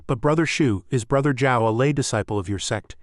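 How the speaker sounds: plain reading voice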